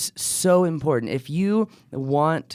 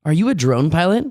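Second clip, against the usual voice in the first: upbeat, louder sponsor pitch; ad read; 4 semitones higher, 4 dB louder than usual. Acted. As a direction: sell it like a commercial.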